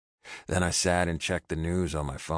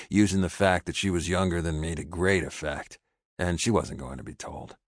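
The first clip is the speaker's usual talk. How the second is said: ordinary delivery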